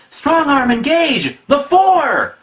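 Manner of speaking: high, drawn-out comic-book cry; overblown exclamation. Acted. Reviewer reads the triple exclamation points, overblown shout. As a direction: build to a loud, high shout.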